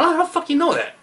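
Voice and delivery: quick, high mock disbelief; amazed guy. Coach repeating the amazed guy's reaction, fast and high. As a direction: rattle it off like a stunned guy. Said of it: "And that makes guys".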